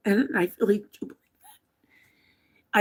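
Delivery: slow, hushed whisper; whispered heartfelt belief. Real, not acted. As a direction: breathe it to yourself. Quiet aside after reading a letter.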